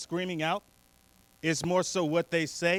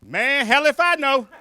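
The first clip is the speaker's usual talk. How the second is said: loud, high, stretched-out mock yell; hollered reply; 6 semitones higher, 8 dB louder than usual. Imitated guy answers the screaming lady across the room, hollering. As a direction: yell back just as baffled.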